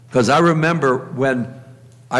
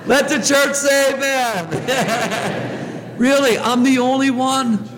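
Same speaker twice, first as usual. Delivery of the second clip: laughing through the words, pitched up; 9 semitones higher, slower than usual. Real, not acted. Priest telling the congregation, laughing and high. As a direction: let the laughter spill into the line. Laughing at his own story.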